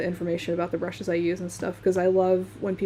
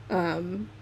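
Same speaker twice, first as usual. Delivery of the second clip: usual speaking voice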